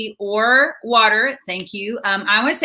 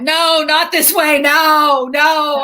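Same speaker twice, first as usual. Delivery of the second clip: protesting no; 4 semitones higher, 4 dB louder, much slower than usual. Retold or imitated. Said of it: "I went".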